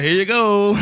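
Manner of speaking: lightly voiced offer; imitating handouts. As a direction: mimic someone cheerfully handing things out. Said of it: "They were like".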